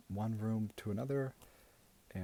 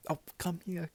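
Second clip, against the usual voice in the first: gushing auntie voice; 2 semitones higher than usual. Acted. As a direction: play a doting, gossipy aunt. Plays a gushing auntie character.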